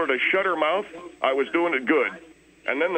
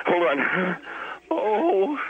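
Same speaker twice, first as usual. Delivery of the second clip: winded gasps between words; put-on panting, ending in a moan; acted out of breath; much slower than usual. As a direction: re-enact struggling for breath. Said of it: "when I'm out of breath".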